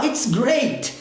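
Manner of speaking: expressive "it's great" with an audible final T; released T exclamation. Acted. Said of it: "americano soltar o T quando for uma expressão".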